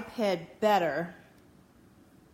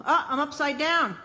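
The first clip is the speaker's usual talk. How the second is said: startled, louder and higher, drawn out; surprised; 6 semitones higher, 6 dB louder than usual. Real, not acted. Streamer startled at her flipped camera, loud surprised cry. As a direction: caught off guard by the flipped picture.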